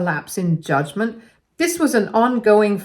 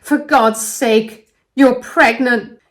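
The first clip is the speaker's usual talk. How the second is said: yelling louder and higher at her best friend; 5 semitones higher, 6 dB louder than usual. A narrator's reading. Wife yelling at her pregnant best friend in shock. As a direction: let it burst out as a stunned yell. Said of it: "I yelled at her".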